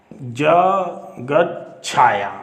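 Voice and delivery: जगत छाया spoken very slowly, each syllable stretched; unhurried board-writing voice; slow dictation. Shown as a demonstration. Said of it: "ये देखो".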